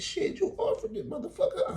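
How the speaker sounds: high put-on mugger voice; the mugger taunts the victim, high and cocky. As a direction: squeak it like a cocky thief. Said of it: "He said".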